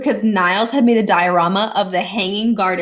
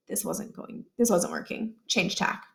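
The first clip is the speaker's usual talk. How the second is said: hushed inner thought; 8 dB quieter than usual. Acted.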